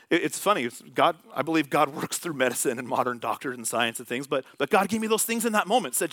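ordinary talk

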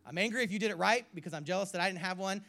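loud, high outburst of anger; retold anger. Preacher relives his twenties' anger at all dads, angrily. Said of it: "and being angry".

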